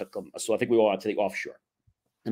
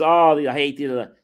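louder retold rant, drawn out; mock yelling; 3 semitones higher, 6 dB louder, slower than usual. Pissed-off kid back from getting a ticket, mock yelling. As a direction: imitate a ticketed friend griping loudly. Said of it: "yelling, screaming".

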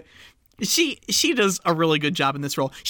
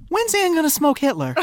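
eager student; 11 semitones higher than usual. Retold or imitated. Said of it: "she goes".

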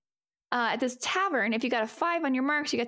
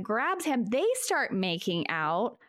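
normal talking voice

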